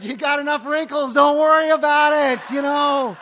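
loud, high teasing comeback; mock teasing shout. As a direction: yell the joke back at her playfully. Joking retort to old lady.

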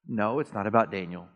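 normal talking voice

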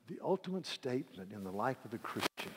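ordinary delivery

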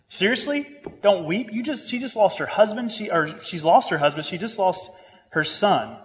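high-pitched, disbelieving questioning; incredulous objection. Acted. Preacher voicing an objection to an inconsiderate remark, incredulous. Acts out indignant reply to quote.

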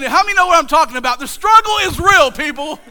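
loud, high preacher's call to the crowd; preaching hard. Real, not acted. Pastor calling out to his congregation, preaching full force.

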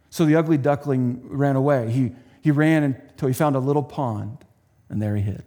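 usual speaking voice

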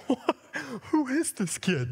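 put-on high, astonished "who is this kid"; amazed disbelief. Retelling youth camp night, amazed high disbelief. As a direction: replay your own stunned reaction. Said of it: "And I'm like".

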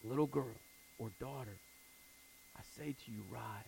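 very soft and low, gentle; hushed tender command; tender whisper-soft call. Acted. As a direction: coax a child awake. Jesus wakes the dead girl.

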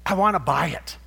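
eager buyer. Retold or imitated. Man spots the most beautiful pearl, eager. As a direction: declare it with sudden eagerness.

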